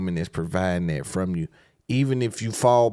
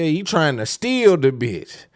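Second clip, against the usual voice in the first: ordinary talk, nothing marked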